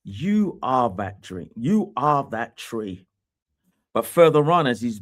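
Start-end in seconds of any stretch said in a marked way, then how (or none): 0.0-3.0 "You are that tree", slow, with "You" stressed each time; slow repeated emphasis. Acted. Preacher repeating Daniel's words to the king, slow and emphatic. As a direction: drive it home slowly.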